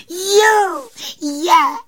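very high, loud joyful fairy shout; happy cry. Acted. Fairy cries out happily as her hair grows back, high and loud. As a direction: burst out with delight. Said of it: "vykřikla šťastně plavůňka".

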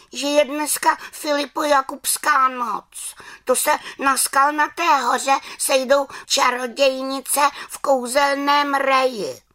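very high, aggrieved witch voice; cross little witch. Acted. Little witch complaining she is barred from the dance, high aggrieved voice. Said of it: "protože mám vztek".

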